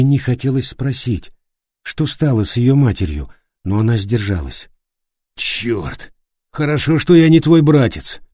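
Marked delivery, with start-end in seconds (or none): 5.3-8.2 hoarse wounded voice. A narrator's reading. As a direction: rasp it out through pain. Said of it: "хрипло проговорил Флориан". (хриплый голос)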